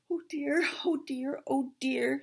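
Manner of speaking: high, fretful little piglet voice; anxious high-pitched character muttering; worried Piglet. Acted. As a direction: fret to yourself in a high little voice. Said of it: "said Piglet to himself".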